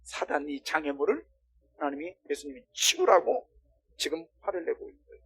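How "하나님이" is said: ordinary talk, nothing marked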